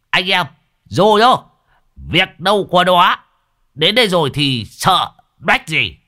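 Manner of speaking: rowdy relieved celebration; whooping. A narrator's reading. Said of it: "rú lên".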